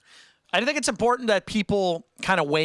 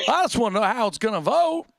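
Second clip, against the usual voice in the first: a light mimic of the clip line, higher and louder; imitating Barney Fife; 6 semitones higher, 3 dB louder than usual. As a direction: parrot the deputy's line back. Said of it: "clip line just played".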